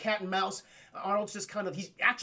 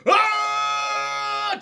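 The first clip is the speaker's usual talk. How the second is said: long high furious yell; raging jungle roar; Tarzan roar; 13 semitones higher, 10 dB louder than usual. Retold or imitated. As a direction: bellow it like Tarzan in pure fury. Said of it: "this Tarzan roar of pure fury".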